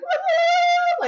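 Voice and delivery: shrieked high, loud woo; bursting party cheer; cheery woo. Retold or imitated. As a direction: whoop it out like the happy-go-lucky one. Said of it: "I'm always like".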